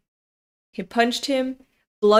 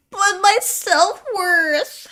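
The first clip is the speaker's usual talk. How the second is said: high, drawn-out mock lament; mock wailing; 9 semitones higher, much slower than usual. Reader imitating the defeated rival, high exaggerated wail. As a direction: whine it slowly, high and wounded. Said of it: "he was like".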